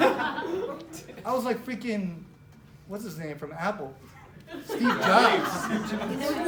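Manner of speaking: slow, laughing delivery; laughing through it. Real, not acted. Telling the crowd about his firing, laughing. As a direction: giggle through the words.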